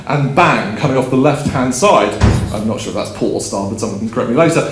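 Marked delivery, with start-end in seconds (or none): none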